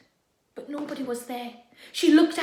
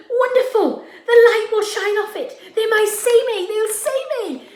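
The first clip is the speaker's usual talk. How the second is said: high, loud and thrilled; excited; 9 semitones higher, 11 dB louder than usual. Acted. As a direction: burst with relief and joy. Overjoyed at finding her shirt.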